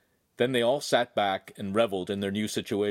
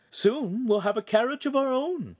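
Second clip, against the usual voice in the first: proud mother; 14 semitones higher than usual. A narrator's reading.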